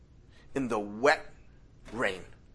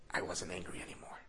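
hushed, anger gone; whisper; 5 semitones lower, 11 dB quieter than usual. Retold or imitated. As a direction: let the storm drain away into a whisper. Anger passes, calm returns.

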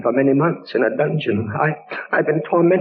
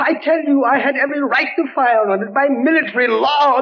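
much higher, urgent insistence; desperate protest; 12 semitones higher than usual. Acted. Captain defending his act to the judges, urgent high protest. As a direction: plead your right with rising desperation.

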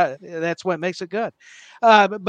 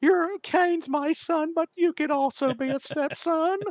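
high, slow, creepy manager voice; Paul Bearer impression; 10 semitones higher than usual. Paul Bearer disowning Mankind, in a high slow whine. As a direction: keep the high drawn-out whine going. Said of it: "That's a brilliant impression".

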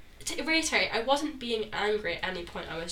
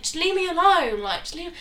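raised, loud leave me alone; exasperated shout; 7 semitones higher, 6 dB louder than usual. Retold or imitated. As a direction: yell it at him to back off. Acted quarrel with boyfriend.

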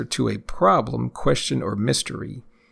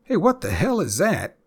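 much higher, punchy what the hell; surprised question; 7 semitones higher than usual. Shown as a demonstration. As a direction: sound startled by what you see. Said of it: "So, for example".

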